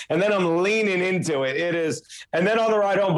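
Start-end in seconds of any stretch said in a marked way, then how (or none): none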